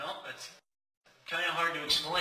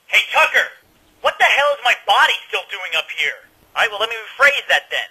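high-pitched, exasperated rant; worked-up, higher-voiced griping; indignant complaint; 7 semitones higher, 5 dB louder than usual. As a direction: call out and complain in raised indignation. Irate complaint called to Tucker.

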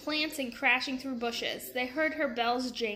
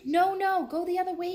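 higher, louder panicked shout; alarmed cry; 7 semitones higher, 5 dB louder than usual. A narrator's reading. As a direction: cry out to stop the elephant. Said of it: "cried Jack".

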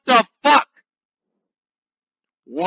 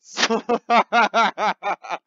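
laughing; 5 semitones higher, 5 dB louder than usual. Real, not acted.

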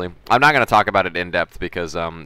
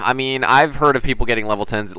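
ordinary talk

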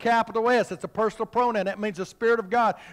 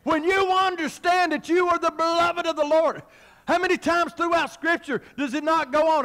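higher-pitched sermon declaration; forceful preaching; 8 semitones higher than usual. Real, not acted. Preacher telling the church they are beloved, forceful preaching. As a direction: preach it out to the congregation.

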